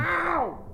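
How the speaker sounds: high, short mock yelp; sudden pretend ouch; yelp of pain. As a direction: cry out as if slapped. Player cries out at mimed slap.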